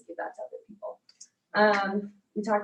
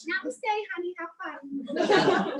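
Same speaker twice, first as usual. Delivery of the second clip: higher, half-laughing imitation of a New England accent; New England mom; 11 semitones higher, 5 dB louder, much slower than usual. Mom sending her daughter off to yoga, in a laughing New England accent. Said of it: "very, very, um, New England accent".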